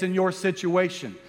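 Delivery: ordinary delivery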